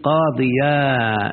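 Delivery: قاضيا said very slowly, holding the final alif; slow demonstration of قاضيا; stretched final alif. Shown as a demonstration. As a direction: drag out the ending of قاضيا. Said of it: "تقف عليها بالألف".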